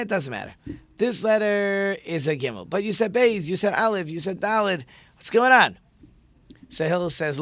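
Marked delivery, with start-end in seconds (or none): 2.9-5.8 high, loud bewildered cry; exasperated outburst. Acted. Convert baffled by the shifting letters, loud exasperated cry. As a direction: throw up your hands in confusion.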